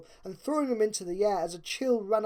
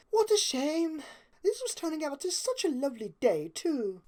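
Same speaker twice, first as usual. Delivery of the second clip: much higher, refined lady voice; Rarity's voice; 8 semitones higher than usual. A narrator's reading. Rarity agreeing with Fluttershy about the coming storm. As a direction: play the elegant unicorn, a touch put out.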